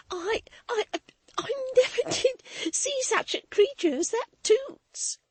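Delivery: high, breathless, half laughing; laughing through tears. A narrator's reading. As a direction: giggle and cry at once. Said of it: "laughed and cried a little more".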